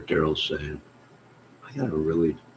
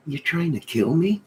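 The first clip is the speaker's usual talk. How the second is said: incredulous retort; 12 semitones higher, 4 dB louder than usual. Retold or imitated. Mock outrage at being told to exercise.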